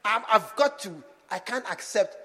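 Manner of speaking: normal talking voice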